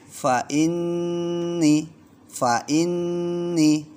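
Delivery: fa inni, nn ditahan lama dan tinggi; held gunnah. Shown as a demonstration. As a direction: tahan nn di inni dua ketukan. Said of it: "Bacanya dua ketukan". (fa inni dengan dengung panjang)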